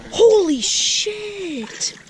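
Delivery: high drawn-out gasp of shock; shocked cry at cold water. Real, not acted. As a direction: yelp as the cold hits. Stepping into cold river.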